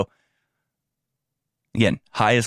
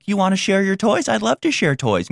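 mock naive child, a touch higher; 8 semitones higher than usual. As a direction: play the trusting kid offering toys. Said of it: "innocent, naive children".

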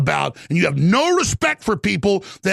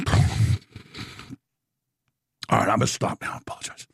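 low and soft, fading away; hushed, deflated sign-off from a rant; subdued apology; 10 semitones lower, 5 dB quieter, much faster than usual. Real, not acted. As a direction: back down, dropping to a murmur.

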